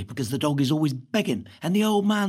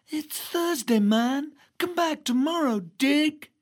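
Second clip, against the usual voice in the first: higher, laid-back hippie tone; hippie sister; 8 semitones higher, slower than usual. Acted. Lucy tells him to come back tomorrow, hippie voice. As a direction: play it cool like a hippie.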